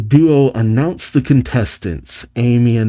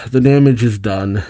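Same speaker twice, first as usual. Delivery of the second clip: usual speaking voice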